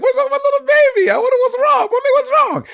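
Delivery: put-on very high, rushed baby talk; mock cooing concern. Throwing co-host's words back in an argument, mock cooing concern. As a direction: imitate someone fussing over a baby. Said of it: "You were like".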